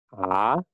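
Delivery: A sliding upward; rising tone A. Shown as a demonstration. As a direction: lift the pitch through A like a question. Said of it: "Nada naik ini bacanya juga naik". (A dengan nada naik)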